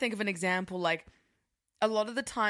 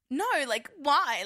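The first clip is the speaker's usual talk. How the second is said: higher, louder put-on girly act; demonstrating an exaggerated pick-me act; 6 semitones higher, 4 dB louder, slower than usual. Shown as a demonstration. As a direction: put on a voice to impress a guy. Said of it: "I do this voice. I'll be like".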